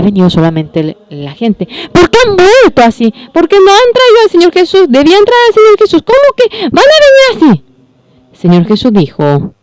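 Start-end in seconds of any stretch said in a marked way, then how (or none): 1.9-7.6 much higher, louder, fast upset questioning; upset complaint. Acted. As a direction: voice the sisters' frustration, pitch up high. Sisters upset messenger returned alone.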